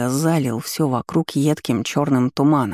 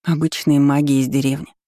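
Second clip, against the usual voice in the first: ordinary delivery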